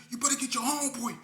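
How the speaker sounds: urgent threat to the driver; telling K C to get his dog; warning the driver. Retold or imitated. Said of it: "I'm telling K C".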